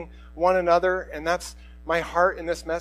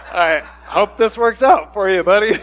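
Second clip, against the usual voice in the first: laughing farewell; 4 semitones higher, 9 dB louder than usual. Retold or imitated. Owner sending dog off with broke adopter, laughing. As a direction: wish luck through laughter, jokingly doubtful.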